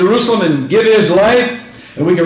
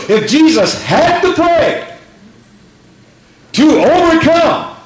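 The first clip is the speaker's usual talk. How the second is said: rising fervor; 8 semitones higher than usual. Real, not acted. Preacher rising to a climax.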